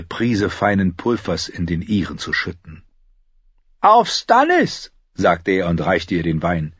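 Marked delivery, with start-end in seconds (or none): 3.8-4.9 high, loud, drawn-out cheer; hearty toast. Acted. Tyrion toasting Stannis with his sister, hearty. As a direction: raise the cup and call it out slowly.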